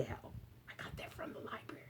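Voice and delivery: breathy confiding whisper. Acted. Teacher whispering to the children where the book came from. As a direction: share it as a little secret.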